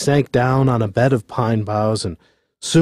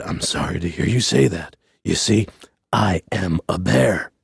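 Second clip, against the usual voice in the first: low, meek bear voice; apologetic bear; 6 semitones lower, 3 dB quieter than usual. A narrator's reading. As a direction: explain gently in a deep bear voice.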